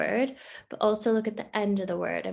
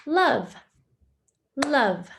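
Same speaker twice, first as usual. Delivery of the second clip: "Love" said firmly as one clapped beat; clapped syllables; 3 semitones higher, 4 dB louder than usual. Shown as a demonstration. Teacher claps "love" in one beat for the class. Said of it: "clapping syllables for pupils".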